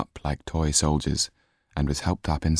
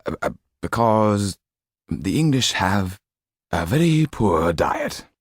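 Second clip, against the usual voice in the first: put-on mildly flustered old lawyer; floundering; 3 semitones higher than usual. Lawyer dodging Sido's question, floundering. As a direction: grab for any excuse.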